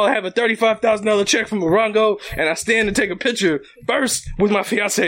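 ordinary talk

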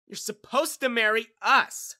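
loud, high, drawn-out scream; shrieked demand. A narrator's reading. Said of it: "screamed Mary".

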